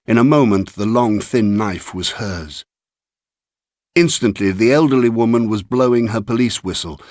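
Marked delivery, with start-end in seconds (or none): none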